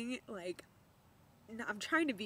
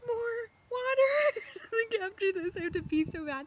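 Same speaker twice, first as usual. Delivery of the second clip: high, loud, near-crying outburst; outraged disbelief; 13 semitones higher, 7 dB louder than usual. Retold or imitated. To the nurse who wants more water, a high, loud, tearful wail.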